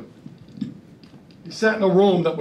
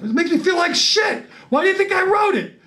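indignant outburst; 10 semitones higher than usual. Retold or imitated. Angry reply to therapist.

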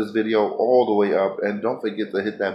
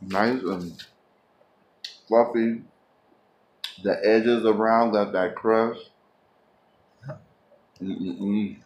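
talking with food in mouth; mid-bite mumbling; chewing while talking; slower than usual. Real, not acted. Seen 0: bite taken at -2s, jaw working through speech, lips closed at end.

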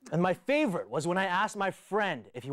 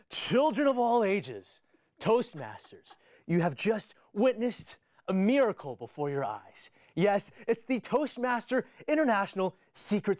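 home shopping host; 2 semitones higher than usual. Acted. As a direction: sell it like a late-night infomercial. Infomercial pitch for magic water.

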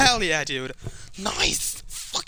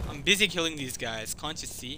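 ordinary delivery

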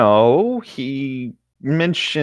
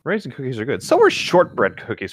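ordinary talk, nothing marked

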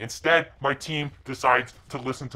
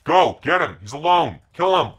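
loud, higher rapid calls to attack; excited shouting; 4 semitones higher, 7 dB louder than usual. Real, not acted. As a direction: fire the team up for the kill. Hyping team during a kill.

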